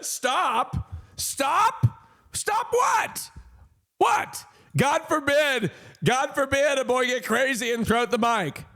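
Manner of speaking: high-pitched, incredulous protest; mock indignation. Streamer protesting to chat's scolding, mock indignant. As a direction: squeal in pretend outrage at being told off.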